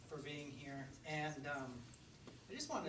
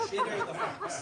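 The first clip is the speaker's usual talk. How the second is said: much higher and louder, bursting with laughter; laughing exclamation; 12 dB louder than usual. Shown as a demonstration. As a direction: shout the name while laughing. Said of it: "which is S C H I D D E R".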